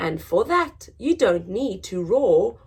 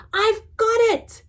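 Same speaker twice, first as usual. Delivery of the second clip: much higher, a bright squeaky eureka; excited; 13 semitones higher, 4 dB louder than usual. Acted. Mouse jumps from bed, excited and much higher. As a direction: burst out with the idea. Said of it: "he said".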